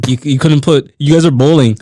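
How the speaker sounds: ordinary talk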